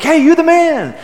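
high, loud, cheery praise; teammate compliment. Retold or imitated. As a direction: hype up a buddy who just hit a big shot. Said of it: "we would often say to each other".

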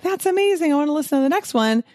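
high, fast, eager fan; imagined excited listener. Pretend. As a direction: gush like a new listener who's hooked. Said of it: "they're like".

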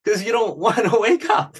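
high, laughing mock complaint; mock grumpy laugh. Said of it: "I'm like".

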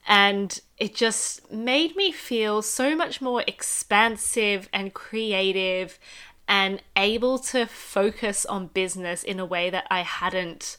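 ordinary talk, nothing marked

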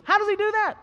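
loud, high, slowing preacher's build-up; preaching at full force. Acted. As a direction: drive it home to the congregation, climbing.

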